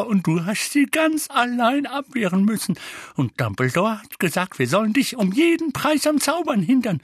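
high, wailing, fretful Mrs Figg; flustered old-lady fretting; agitated old woman. Acted. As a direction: lament the disaster in a worked-up high voice.

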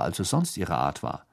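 usual speaking voice

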